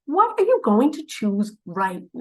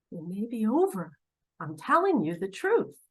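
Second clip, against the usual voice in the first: ordinary talk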